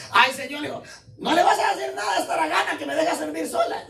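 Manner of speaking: high, whiny mock complaint; Martha complaining. Martha whines to Jesus about serving alone, high and mock-whiny. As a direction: whine it like a put-upon sister. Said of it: "Interrumpió el discipulado para decirle".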